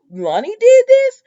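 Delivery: slow, loud, high mock disbelief; shocked question. [name] asks Abe who did it, shocked and incredulous. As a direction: blurt it high and drawn out, acting stunned.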